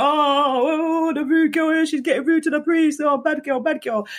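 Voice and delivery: high, jeering singsong taunt; mocking inmates. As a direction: sneer it like a crowd of inmates mocking. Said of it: "everyone's like".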